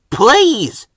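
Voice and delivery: exasperated please. Acted. Murdoch begging the chatterboxes to stop, exasperated. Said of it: "chuffed Murdoch".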